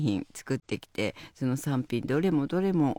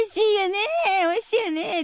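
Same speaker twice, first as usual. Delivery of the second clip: very high, louder cooing with a laugh; baby talk; 5 dB louder than usual. Retold or imitated. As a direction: coo sweetly to a baby. Sanma coos again.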